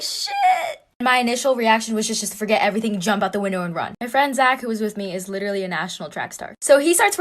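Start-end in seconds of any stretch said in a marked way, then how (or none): 0.0-0.8 very high, drawn-out squeal; comic falsetto cry of alarm; panicked squeal. Retold or imitated. Acted panic on hearing the gun.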